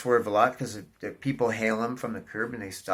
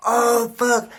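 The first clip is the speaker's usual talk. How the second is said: much higher, loud panicked oh; alarmed curse; 12 semitones higher, 9 dB louder than usual. Retold or imitated. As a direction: yelp it as the pothole appears. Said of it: "I'm like".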